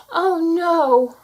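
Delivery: high, loud cry of despair; dismayed cry. A narrator's reading. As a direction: cry out as the door locks. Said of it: "cried Cinderella".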